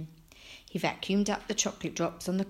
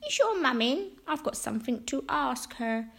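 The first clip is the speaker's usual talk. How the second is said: put-on high, beaming old-lady voice; Missus Cox; 7 semitones higher than usual. A narrator's reading. Missus Cox greeting Franklin at her door, beaming. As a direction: greet him in a pitched-up character voice. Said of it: "Missus Cox beamed".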